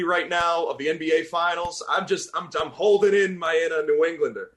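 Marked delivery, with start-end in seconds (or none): 2.3-4.5 raised, loud New England accent; heated Boston sports-fan accent. Real, not acted. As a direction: let the Boston accent slip out, excited. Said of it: "I'm from Boston".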